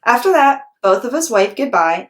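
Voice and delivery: plain reading voice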